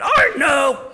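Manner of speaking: sharp high yelled snap; snapped. Retold or imitated. Daughter snapping at mom in the kitchen, loud high snap. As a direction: snap it like an angry teen. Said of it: "she snapped".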